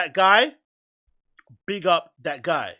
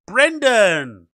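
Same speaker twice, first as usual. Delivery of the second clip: excited reveal; 3 semitones higher, 8 dB louder than usual. Real, not acted. Punchline reveal of the name.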